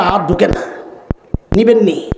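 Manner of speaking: normal talking voice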